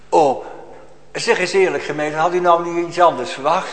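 ordinary talk

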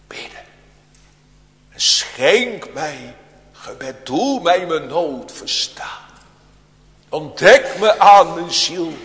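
swelling heartfelt prayer; earnest prayer. Acted. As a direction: pray it as if begging God to open your heart. Preacher voices a plea to God.

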